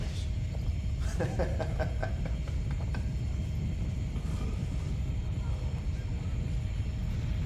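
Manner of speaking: a drawn-out scornful laugh, mostly air; long breathy mocking laugh. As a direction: laugh him off, long and low. Interrogator scoffing at the prisoner's English.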